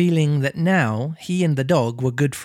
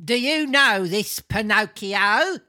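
put-on slow, high comic old man; questioning old man; 7 semitones higher, slower than usual. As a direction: inquire slowly in a pitched-up character voice.

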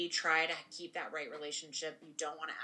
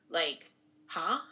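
incredulous laugh; 7 semitones higher, 4 dB louder than usual. Real, not acted. Incredulous reaction to quoted denial.

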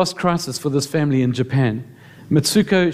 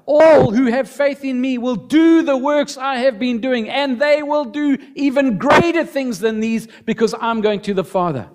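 proclaiming scripture; 9 semitones higher than usual. Retold or imitated. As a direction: cry it out with full force. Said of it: "Jesus said".

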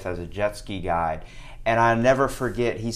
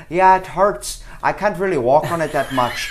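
higher, mock-Irish complaint; Irish accent; 5 semitones higher, 4 dB louder than usual. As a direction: imitate a limping Irishman complaining. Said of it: "he's limping and goes".

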